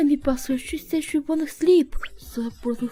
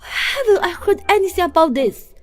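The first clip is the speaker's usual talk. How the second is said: raised, quick, indignant questioning; 4 semitones higher, 5 dB louder than usual. Acted. Woman learns of his relationship, indignant demand. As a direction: grow higher and louder, affronted.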